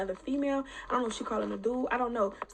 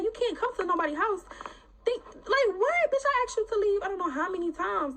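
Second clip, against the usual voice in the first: higher, incredulous yelling at her; outraged; 9 semitones higher than usual. Retold or imitated. Telling the woman off at her door, outraged and higher. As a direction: fire it back in disbelief. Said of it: "But I'm like".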